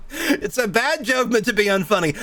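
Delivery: laughing at the joke as you reread it. Real, not acted. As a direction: repeat the line through laughter. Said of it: "rereads quote laughing".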